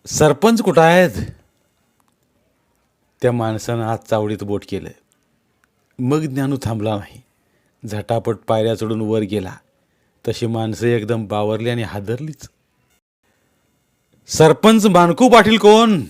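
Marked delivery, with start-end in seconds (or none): 0.1-1.1 a loud, pointed question; loud asking. A narrator's reading. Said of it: "मुद्दामच विचारलं". 14.3-16.0 loud, slow, booming challenge; booming demand. A narrator's reading. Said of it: "त्या आवाजानं देऊळ घुमलं".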